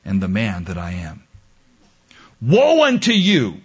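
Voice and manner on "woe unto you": booming prophet's proclamation; mock biblical prophet. Shown as a demonstration. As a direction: thunder it like an Old Testament prophet. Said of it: "Don't leave, I'm just illustrating".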